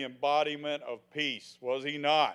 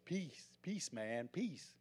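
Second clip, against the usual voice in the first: much softer, spaced-out hippie murmur; lazy, dreamy peace-man drawl; sixties hippie; 3 semitones lower, 14 dB quieter, slower than usual. Retold or imitated. Preacher mocking sixties hippies, soft dreamy murmur. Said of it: "I grew up in the sixties".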